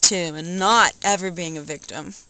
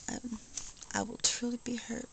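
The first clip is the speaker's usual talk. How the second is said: mock hurt; 14 dB quieter than usual. Mock hurt about the lasagna.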